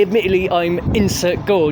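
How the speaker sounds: ordinary talk, nothing marked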